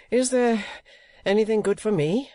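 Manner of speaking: high, anxious questioning. A narrator's reading. Actor asking the playwright about his part, high and anxious. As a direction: ask anxiously, pitched right up. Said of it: "inquired Mister Folair anxiously".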